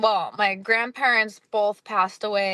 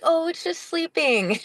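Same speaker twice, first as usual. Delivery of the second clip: higher, sing-song imitation of a doctor; lightly voiced reassurance in a raised pitch; doctor humoring a child; 8 semitones higher than usual. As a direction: mimic talking down to a little one. Quoting doctor soothing a child.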